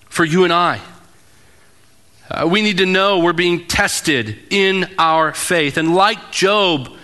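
normal talking voice

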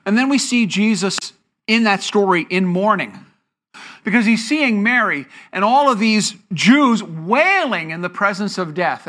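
ordinary delivery

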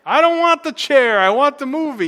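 high, loud imitation of a crying little girl; mock tearful child. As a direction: play up a child's tearful protest, loudly. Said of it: "And she's crying, saying".

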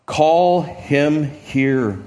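very slow, drawn-out call; a slow call meant to reach the far crowd; Jesus' voice going forth. Acted. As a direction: let the call carry slowly over a distance. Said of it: "Jesus' voice goes forth".

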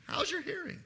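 tiny, high, hushed voice; very quiet demonstration. Shown as a demonstration. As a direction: ask it very softly, pitched high. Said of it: "let me say it like this".

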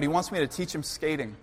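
ordinary talk, nothing marked

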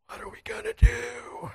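frightened whispered plea; scared hushed whisper; panicked whisper. Retold or imitated. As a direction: whisper it, half laughing at the fear. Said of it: "and I went".

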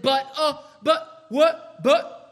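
put-on a kid stammering protests; child caught in the act, sputtering. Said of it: "Children, this is why".

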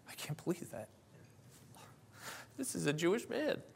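high, soft, half-whispered distress; retold weeping. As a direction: relive being on the verge of tears. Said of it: "And I started to weep".

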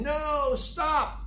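high, urgent cry to halt. Retold or imitated. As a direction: call it out high, drawing out each word. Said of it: "Paul raises his voice".

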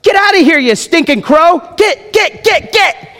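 high frantic shooing; Abram shooing crow. Pretend. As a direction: shoo the crow away with an exasperated yell. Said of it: "looks around like".

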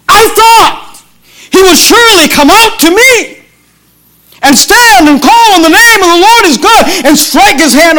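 high-pitched, indignant preaching outburst; mad and angry. Acted. Preacher acting out Naaman's complaint about Elisha, angry and high. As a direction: voice an offended man's angry complaint, pitched well up. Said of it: "he's mad and angry, and he says".

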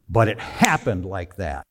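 usual speaking voice

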